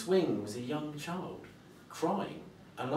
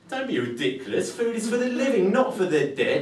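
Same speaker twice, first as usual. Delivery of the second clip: louder, much higher dismissive scolding; scolding boy; 8 semitones higher, 7 dB louder than usual. Acted. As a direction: tell off the dead hand.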